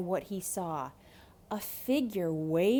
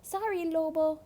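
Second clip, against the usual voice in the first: put-on a bright higher boy's voice brushing him off; polite but hurried, a higher kid voice; boy Rico, higher; 8 semitones higher than usual. Rico to the wolf, refusing him, higher and bright. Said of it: "Rico said".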